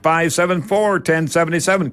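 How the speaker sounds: usual speaking voice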